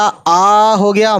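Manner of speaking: आ stretched into a very long open vowel; drawn-out long aa. Shown as a demonstration. Teacher showing Urdu viewers the long aa, slowly. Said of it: "अलिफ पे मद लगाया".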